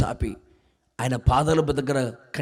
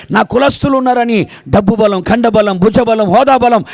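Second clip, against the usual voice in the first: builds back up to a louder preaching cadence; rising preaching; 6 semitones higher, 9 dB louder than usual. Acted. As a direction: lift the congregation, pressing harder with each line.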